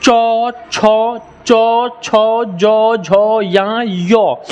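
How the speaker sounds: slow, held चौ, छौ, जौ, झौ, tongue to palate; level, sustained syllables चौ, छौ, जौ, झौ, या, यो; palatal sound drill. Shown as a demonstration. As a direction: sound out चौ, छौ, जौ one by one, very drawn out. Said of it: "जब भी बोले आपका जीभ".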